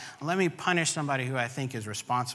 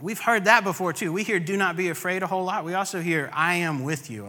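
fervent preaching; 6 semitones higher, 5 dB louder than usual. Acted. Preacher builds intensity again.

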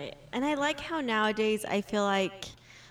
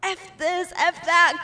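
mock husband cursing, voice jumping higher and louder; angry cursing; 8 semitones higher, 5 dB louder than usual. Wife imitating husband fighting the baby gate, mock angry cursing. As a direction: spit it out like a fed-up husband. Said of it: "and it's like".

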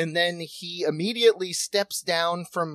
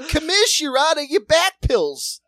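raised, louder jokey whine; mock accusing brother; 8 semitones higher, 5 dB louder than usual. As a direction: needle the commish in a pushy voice. Voicing brother accusing Commish.

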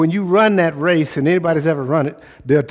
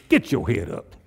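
head-down mumble, lower pitch; slumped head-down version; low, droopy take; 7 semitones lower than usual. Shown as a demonstration. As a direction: say it like you're staring at your feet. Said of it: "Sometimes I'd like to just do this".

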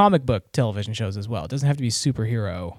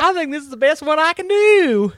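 put-on best Southern accent; 14 semitones higher than usual. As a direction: ham up a Southern accent, way up high. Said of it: "Give me your best Southern accent".

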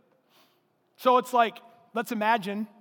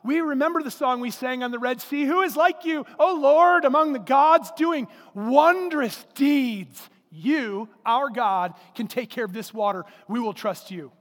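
higher, louder imagined prayer, slowing and settling at the end; fervent mock prayer; 4 semitones higher, 6 dB louder than usual. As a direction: swell into grand praise to the Lord, then settle. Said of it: "They held a prayer meeting. They called on the name".

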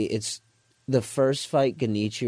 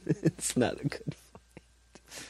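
breathy laughing echo, softer; laughing whisper; 5 semitones higher, 7 dB quieter, much slower than usual. Real, not acted. Echoing the punchline laughing.

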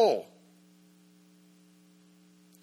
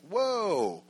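a higher, hushed whoa of awe; awed whoa; 6 semitones higher than usual. Retold or imitated. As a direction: ushers stunned by the interpretation. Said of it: "And they're like".

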